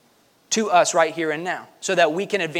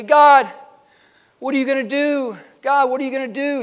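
mock plea to God; 9 semitones higher, 4 dB louder than usual. Voicing people calling up to heaven.

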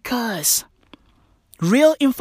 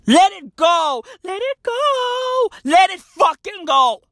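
shouted, pitched up; yelling; 7 semitones higher than usual. Acted. Comic ranter yelling at mask wearers. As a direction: bellow the advice at them. Comedic rant, yelling advice.

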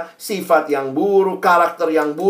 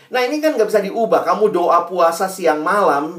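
usual speaking voice

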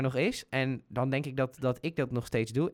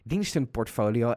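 ordinary delivery

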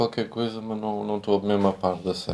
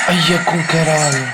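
shocked, high and louder; shocked gasp; 7 semitones higher, 6 dB louder than usual. Real, not acted. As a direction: blurt it out in shock. Reacting to bombing video. (espanto chocado)

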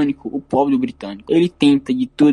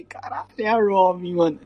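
excited; 4 semitones higher than usual. Retold or imitated. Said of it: "Eu reagi da mesma maneira de novo".

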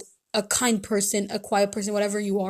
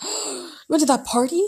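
gasp, then higher outraged question; shocked mom; 4 semitones higher than usual. Retold or imitated. Mother learns her daughter went to the party, gasping in shock. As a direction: gasp and play the appalled mother.

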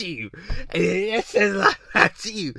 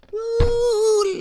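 high-pitched swooning "woo"; mock excited whoop; 4 dB louder than usual. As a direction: let out a long, high mock squeal. Acts out ladies excited by his voice.

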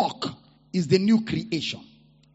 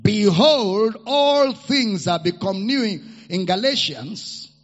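loud, high, fervent sermon delivery; preaching at full force; 3 semitones higher, 4 dB louder than usual. Real, not acted. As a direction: preach it over the music.

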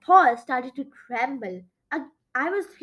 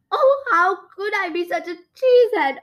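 dismayed, raised-voice outburst; crying out; 6 semitones higher, 7 dB louder than usual. A narrator's reading. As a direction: cry out at yourself, higher and louder. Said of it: "I cried".

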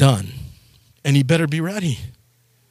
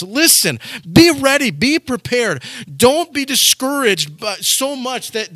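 preaching; 6 semitones higher than usual. Acted. Pastor warning his congregation, fervent higher preaching. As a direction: drive it home to the congregation.

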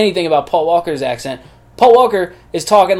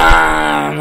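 monster call; 3 dB louder than usual. Acted. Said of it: "it hurts my throat".

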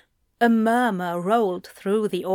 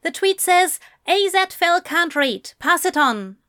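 high, louder reporter voice; journalist reading out; 9 semitones higher, 4 dB louder than usual. Acted. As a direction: report the insult aloud in a raised voice.

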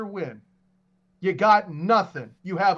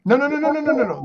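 loud, high rapid-fire string of no's; emphatic objection; 7 semitones higher, 7 dB louder, faster than usual. Real, not acted. Co-host rejecting the host's promised fix, emphatic objection. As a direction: cut in loudly to shut the argument down. Cuts in to object during argument.

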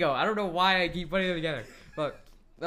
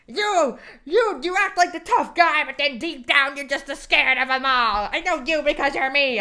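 higher, louder mock heartfelt speech; dramatic speech; 7 semitones higher, 6 dB louder than usual. Mocking Yondu's heartfelt talk to Rocket, higher and louder. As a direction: ham up the big emotional speech. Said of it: "he jumps into".

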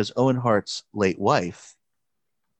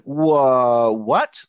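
loud, higher startled "whoa, what"; shocked whoa; 4 semitones higher, 7 dB louder than usual. Retold or imitated. Jim Ross reacting to Vince's order, stunned and loud. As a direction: jolt back in disbelief. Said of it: "And Jim Ross is like".

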